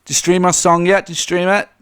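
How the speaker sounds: lightly imitated pushy pop star; a hint of an over-eager self-promoter. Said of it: "like, FaceTiming fans and going".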